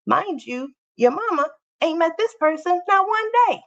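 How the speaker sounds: high exasperated mother's protest; incredulous outrage. Real, not acted. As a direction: rise in disbelief at the foolishness. Incredulous about daughter's trust.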